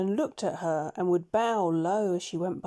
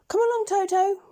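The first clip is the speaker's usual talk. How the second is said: much higher and louder bright call; eager call; 12 semitones higher, 7 dB louder than usual. Acted. Dorothy calling Toto to set off, high and loud. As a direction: call him along cheerfully. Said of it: "she said".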